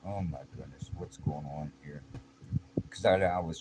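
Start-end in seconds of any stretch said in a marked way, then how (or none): 0.1-2.0 soft, hushed disbelief; watching his sister being choked, stunned. Retold or imitated. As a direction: barely get the words out in shock. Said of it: "I'm all stunned".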